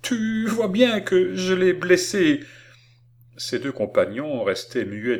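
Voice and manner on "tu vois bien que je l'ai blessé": put-on high, slow wail of a distressed little girl; cri entrecoupé. A narrator's reading. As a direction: draw the words out in a high, pained cry. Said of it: "cria-t-elle d'une voix entrecoupée". (plainte aiguë et traînante, voix entrecoupée)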